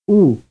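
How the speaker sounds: loud sustained oo; held vowel oo. Shown as a demonstration. Teacher demonstrating a vowel. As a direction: sing out a long oo. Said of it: "And the following?".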